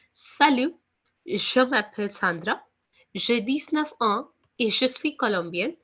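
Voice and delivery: careful French accent, phrase by phrase. Shown as a demonstration. French teacher reads a girl's self-introduction to new students, in French accent. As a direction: read it out in a clear French accent with short pauses. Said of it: "what kind of accent we are using here".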